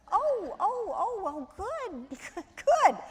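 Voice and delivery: very high, drawn-out mock delight; high swooping put-on cheerfulness; retold flustered reaction. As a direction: squeak it out slowly, feigning that all is fine. Acts out panicked reaction to test news.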